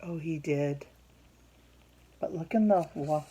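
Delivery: ordinary delivery, nothing marked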